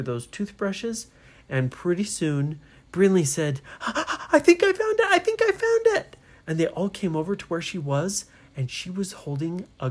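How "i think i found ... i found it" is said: very high, loud excited squeal; thrilled discovery. Acted. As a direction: burst out with the big find. Brinley excitedly announces her find.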